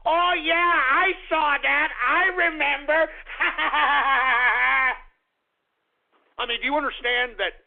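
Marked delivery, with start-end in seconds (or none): 0.1-3.1 higher, louder, slow dim-witted voice; mock simpleton. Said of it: "simplistic ass could go". 3.2-5.0 loud laugh at his own joke; real laugh. Real, not acted.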